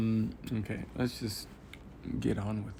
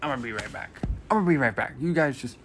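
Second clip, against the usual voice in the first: raised voice on the way out; calling out; 3 semitones higher, 7 dB louder, faster than usual. Real, not acted. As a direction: call it out to the viewers as you leave. Calls out to viewers while leaving.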